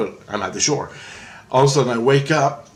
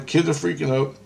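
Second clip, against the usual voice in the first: ordinary delivery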